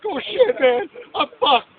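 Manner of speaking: loud, high-pitched alarmed outburst, drawn out at the end; mock panic, stretching the last words; panicked freak-out. Retelling his panic on acid.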